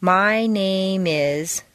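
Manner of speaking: NAME IS drawn out and flowing together; slow, seamless NAME IS; linked words. Shown as a demonstration. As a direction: slowly slide the M of NAME into IS. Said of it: "name is just naturally flows together".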